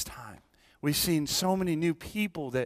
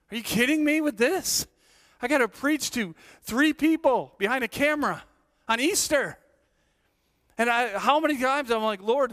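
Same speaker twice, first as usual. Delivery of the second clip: higher, louder exasperated pleading with God; mock frantic prayer; 9 semitones higher, 3 dB louder than usual. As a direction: complain to God in disbelief. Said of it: "Still saying half the time".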